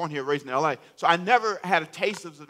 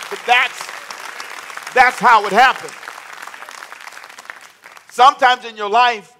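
preaching; 3 semitones higher, 9 dB louder than usual. Real, not acted. Preaching at full force.

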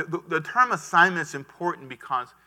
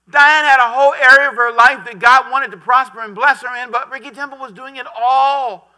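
loud preaching; 9 semitones higher, 11 dB louder than usual. Real, not acted. Preacher building to full force.

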